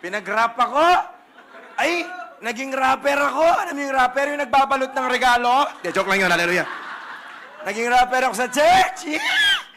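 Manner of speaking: loud, high, laughing hype; excited shouting. Real, not acted. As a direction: hype up the church crowd. Excited rapper story, loud. (masiglang sigaw)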